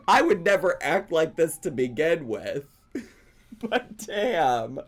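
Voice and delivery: ordinary delivery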